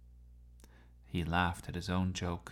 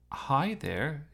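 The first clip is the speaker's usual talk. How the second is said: awkward; 6 semitones higher than usual. A narrator's reading. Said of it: "he said awkwardly".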